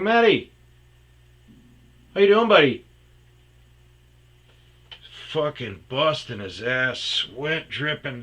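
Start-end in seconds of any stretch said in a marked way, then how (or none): 0.0-2.8 much higher, loud bright hello; cheerful greeting call. Retold or imitated. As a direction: hail a buddy across the room. Calling cheerfully to friend.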